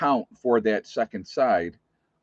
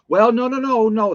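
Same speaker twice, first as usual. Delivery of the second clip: much higher and louder mock panic; mock backpedaling manager; 12 semitones higher, 7 dB louder than usual. Nurse mimics the manager backing down, high mock panic. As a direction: imitate the manager suddenly backing down. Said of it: "her tune changed after".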